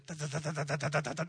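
a quick rhythmic da-da-da for a rattling fender; mouth-made rattle of an old truck; rattling fender noise. Retold or imitated. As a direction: imitate a loose fender clattering down the road. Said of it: "you can hear the rattling".

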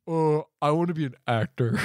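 imitating a dim-witted boy, lightly; a hint of a dopey kid; lightly voiced dumb kid. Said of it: "dumb kid, the boy is like".

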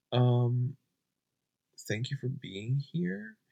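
normal talking voice

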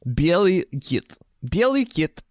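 "Bieli kit" said slowly in careful Russian; high, careful demo of Russian "Bieli kit"; Russian pronunciation. Shown as a demonstration.

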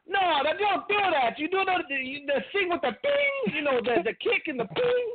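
put-on high Italian accent giving wrestling advice; Pat Patterson voice. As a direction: ham up a broken-English Italian coach. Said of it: "Pat Patterson was like".

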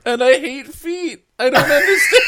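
very high, loud laughing squeal; shrieking with laughter at herself. Real, not acted. Laughing disbelief at self.

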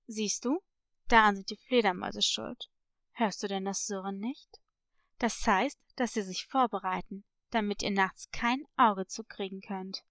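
low-pitched, knowing parrot voice; mysterious parrot. Acted. Parrot revealing a village secret to a girl, low and mysterious. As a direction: question them in a low, leading voice.

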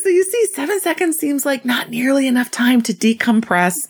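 laughing while reading. Real, not acted. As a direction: fight the laughter as you read. Reading letter through laughter.

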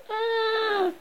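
long high scream; acted high-pitched scream. A narrator's reading.

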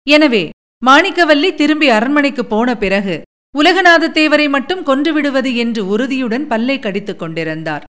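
ordinary delivery

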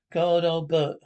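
loud happy reunion; loud delighted greeting. Acted. Greeting old friend loudly.

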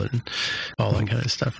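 ordinary talk

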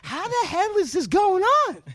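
high incredulous exclamation; mock astonished inner voice; disbelief. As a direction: ask it in stunned disbelief. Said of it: "I think to myself".

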